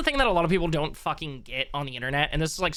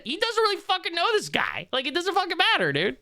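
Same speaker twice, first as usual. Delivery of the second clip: exasperated protest; 12 semitones higher than usual. Real, not acted. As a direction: voice shoots high in exasperation. Exasperated rant about who knows whom.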